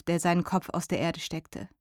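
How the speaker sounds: ordinary delivery, nothing marked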